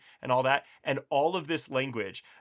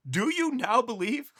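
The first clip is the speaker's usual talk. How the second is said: slow, high mock disbelief; incredulous; 10 semitones higher, slower than usual. Teacher reads Jesus's question to disciples with mock disbelief. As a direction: drag it out as if asking really?.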